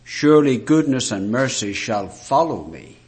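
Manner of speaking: ordinary talk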